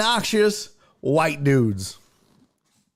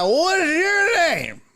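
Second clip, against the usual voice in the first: high, slow, loud boozy shout; drunk shout; 10 semitones higher, 4 dB louder, slower than usual. Retold or imitated. Drunk guest shouts his question at the wife, high and loud. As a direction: yell it like a drunk at a party.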